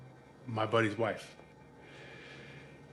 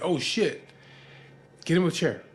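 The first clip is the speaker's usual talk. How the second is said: higher, startled exclamation; alarmed friends; 6 semitones higher, 5 dB louder than usual. Retold or imitated. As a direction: imitate friends reacting in alarm. Friends react to his collapse.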